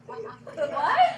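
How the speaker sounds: loud high laughing what. Pretend. Teacher reacting to the silly song, laughing. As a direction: burst out laughing in mock shock.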